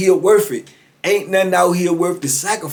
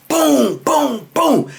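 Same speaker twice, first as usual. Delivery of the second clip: imitated banging; 9 semitones higher, much slower than usual.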